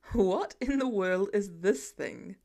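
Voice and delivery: slow, baffled low voice; a hint of gruff disbelief; lightly voiced Tony, slow. A narrator's reading. Tony eyeing the elf doll.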